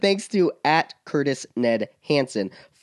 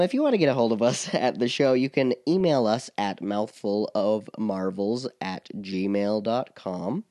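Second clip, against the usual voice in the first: ordinary delivery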